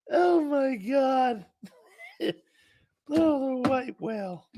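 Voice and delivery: high, slow words through real laughing; helpless laughter. Real, not acted. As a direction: still cracking up, barely getting it out. Cracking up at his own joke.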